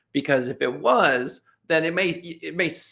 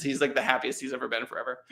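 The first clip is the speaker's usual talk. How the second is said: usual speaking voice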